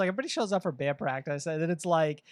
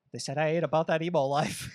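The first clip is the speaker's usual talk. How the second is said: ordinary delivery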